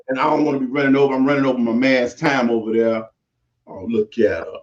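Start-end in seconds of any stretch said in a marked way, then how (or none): none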